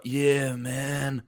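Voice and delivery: a relaxed Californian "Yeah, man"; Cali accent. Shown as a demonstration. Showing his Cali accent to fellow players, laid back Californian drawl. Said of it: "the kind of Cali kind of like".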